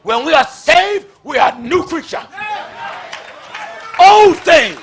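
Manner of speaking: fiery shouted preaching. Acted. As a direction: preach it hard to the pews. Preaching to the congregation.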